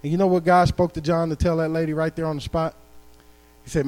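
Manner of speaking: usual speaking voice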